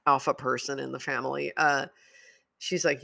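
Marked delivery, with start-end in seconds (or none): none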